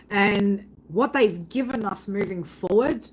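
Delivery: usual speaking voice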